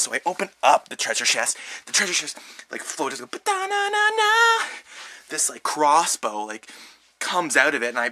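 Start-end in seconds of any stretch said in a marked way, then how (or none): 3.3-4.7 high, slow sung jingle; magical reveal jingle. Retold or imitated. Treasure chest floating open, a high sung jingle. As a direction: sing the crossbow's grand reveal.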